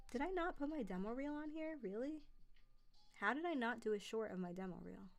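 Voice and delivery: ordinary talk, nothing marked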